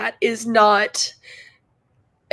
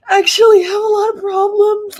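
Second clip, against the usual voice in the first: tearful; 8 semitones higher, 5 dB louder than usual. Real, not acted. Still crying.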